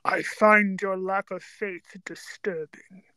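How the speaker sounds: slow, low, whispery villain voice; measured, menacing movie-villain impression; Darth Vader. Dark lord force-choking a doubting general, slow and low menace. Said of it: "I can't do a Darth Vader voice. At least, can you do it?".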